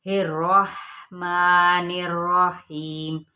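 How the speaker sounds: slow, drawn-out chanted recitation; slow tajweed chant; Quran recitation. Shown as a demonstration. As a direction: recite it slowly, giving each sound its full length. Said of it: "रॉ पुर पढ़ा जाएगा".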